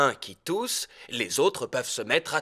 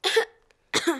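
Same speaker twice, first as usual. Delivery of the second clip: cough. Acted. Doctor coughs.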